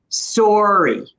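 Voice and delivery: "Sorry" with the o of sore; rounded Ontario "Sorry"; Canadian sorry. Shown as a demonstration. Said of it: "It's that same pronunciation".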